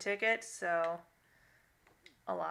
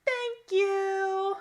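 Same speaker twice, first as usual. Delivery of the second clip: put-on much higher, tiny toddler thank-you; 12 semitones higher, 4 dB louder than usual. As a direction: chirp it like a baby just learning to talk. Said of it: "and she goes".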